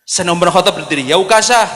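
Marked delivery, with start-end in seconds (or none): 1.1-1.7 loud, high challenge; bold warrior's call. Acted. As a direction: call him out like a fearless champion. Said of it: "pendekar Islam". (seruan lantang seorang pendekar)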